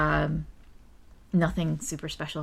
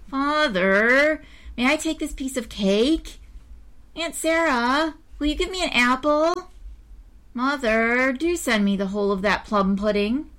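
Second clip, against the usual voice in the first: whining; 7 semitones higher than usual. A narrator's reading. Greedy boy begging his family for treats, whining. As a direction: beg for treats in a higher whine. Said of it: "in a whining tone of voice".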